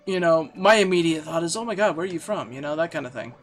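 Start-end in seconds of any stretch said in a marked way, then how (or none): none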